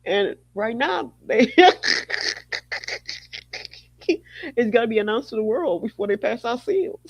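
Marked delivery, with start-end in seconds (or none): 1.6-3.7 silent wheezing laugh; breathless laugh. Real, not acted. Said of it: "I can't stop laughing".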